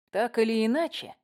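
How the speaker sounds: plain reading voice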